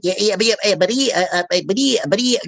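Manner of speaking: stuttering cartoon pig voice; Porky Pig. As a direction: stammer in bursts like a cartoon pig. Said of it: "You know, Porky Pig?".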